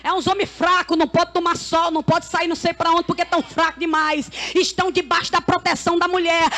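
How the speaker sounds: ordinary talk, nothing marked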